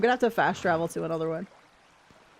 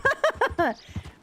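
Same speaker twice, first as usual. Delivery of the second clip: high incredulous laugh; disbelieving laugh. Real, not acted. Streamer laughing as the bodies vanish, high and incredulous.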